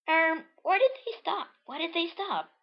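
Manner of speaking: very high questioning character voice; high cartoon puzzlement; Marina's voice. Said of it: "says Marina".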